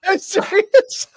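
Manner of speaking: high, loud laughing surprise; laughing disbelief. Real, not acted. Host laughing at the guest's Canada story, amused disbelief.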